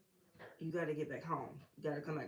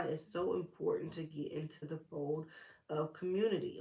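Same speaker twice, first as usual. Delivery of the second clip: normal talking voice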